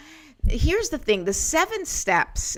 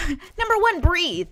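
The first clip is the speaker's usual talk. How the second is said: high, loud, half-laughing exclamation; emphatic repeat; 9 semitones higher, 5 dB louder, slower than usual. Real, not acted. Host repeats step one to viewers, high and emphatic. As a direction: burst out with it after a quick laugh.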